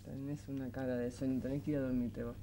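very low, soft bedtime murmur; winding down. Acted. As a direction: quietly nudge a sleepy child toward bed. Mother sends sleepy son to bed.

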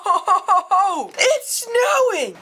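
high excited ho ho ho; excited shout. Real, not acted. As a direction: shout it out with glee. Excited about the snow.

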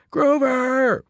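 high, loud call; shouted name. A narrator's reading. Friends yelling at gamer friend, loud and high. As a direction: shout his name to snap him out. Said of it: "we both shouted".

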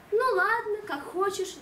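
shrugging mother. Acted. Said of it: "дёрнула мама плечом".

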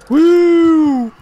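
high, drawn-out "woo"; high-pitched excited whoop; loud whoop. Real, not acted. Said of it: "Lotte vraagt of je niet zoveel schreeuwt".

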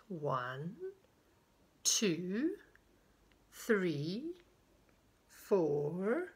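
slow count, each number swooping up; singsong counting; hide-and-seek counting. Acted. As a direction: pause between numbers, lifting each at the end.

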